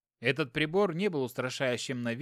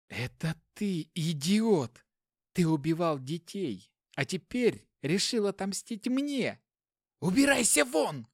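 angry shout; 5 semitones higher, slower than usual. Acted. Girlfriend driving the inspector out.

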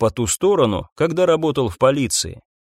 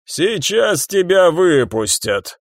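slow, raised voice of a prison guard; metallic guard's voice; 5 semitones higher, slower than usual. A narrator's reading. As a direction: call it out slowly as the warder. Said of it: "металлический голос надзирателя". (металлический голос надзирателя)

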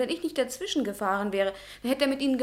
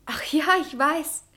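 normal talking voice

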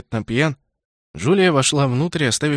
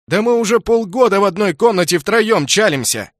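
indignant complaint; 8 semitones higher, 5 dB louder than usual. Acted. Brother complains loudly.

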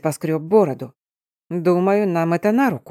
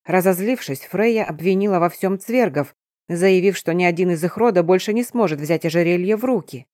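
ordinary delivery, nothing marked

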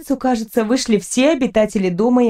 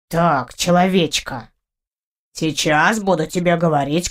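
low, self-important cat giving orders; commanding low creature voice; bossy cat character; 5 semitones lower than usual. Acted. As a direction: instruct your human in a deep, lordly voice.

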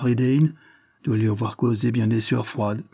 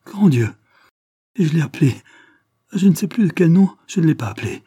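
horrified exclamation; 7 semitones higher, 6 dB louder, faster than usual. Acted. Adélaïde horrified at the revelation.

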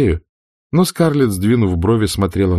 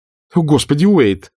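put-on higher and louder, snapping at the boy; irritated exclamation; 7 semitones higher, 4 dB louder than usual. A narrator's reading. Scarlett to Wade, exasperated outburst. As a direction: Scarlett snaps at her fidgeting son, irritated. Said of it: "раздражённо воскликнула".

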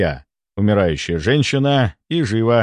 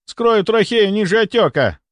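high-pitched surgical announcement; urgent insistence; 10 semitones higher, 3 dB louder than usual. A narrator's reading. A doctor with a scalpel announces he will cut, urgently. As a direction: press the plan, pitch raised.

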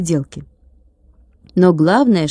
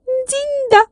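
high tiny voice, cut short; the glass man snapping shut the talk; curt ringing. A narrator's reading. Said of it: "отрезал Тилька". (отрезал, тонким звенящим голоском)

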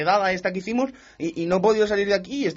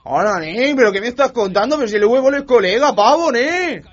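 high, loud outburst of outrage; worked-up, loud disbelief; indignant protest; 4 semitones higher, 5 dB louder than usual. Acted. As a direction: protest loudly, pitch climbing. Told he cannot change his vote.

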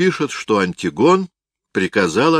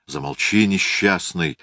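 ordinary delivery

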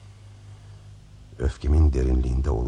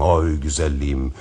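normal talking voice

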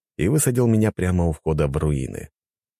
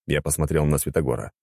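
usual speaking voice

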